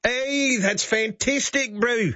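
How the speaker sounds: high, bright delight. Real, not acted. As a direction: cheer the joke on. Delighted reaction to the joke.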